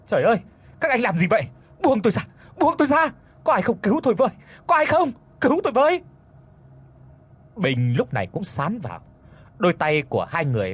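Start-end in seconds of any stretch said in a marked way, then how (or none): 0.1-6.0 very high, panicked cries; screaming for help. A narrator's reading. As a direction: cry out in terror, pleading to be released. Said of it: "Cô gái hét lên".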